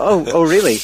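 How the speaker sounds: loud, high sarcastic astonishment; mock surprise. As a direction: feign shock at something obvious. Sarcastic feigned surprise at business decision.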